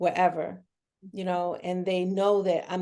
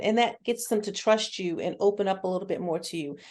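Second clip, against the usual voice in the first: ordinary talk, nothing marked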